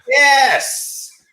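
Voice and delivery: high, shouted hype man cheer. Retold or imitated. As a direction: yell it like the sidekick hype man. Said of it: "And the guy's like".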